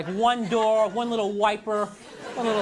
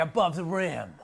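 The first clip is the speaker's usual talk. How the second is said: mock tough jock; 5 semitones lower, slower than usual. Dork reading his sports shirt.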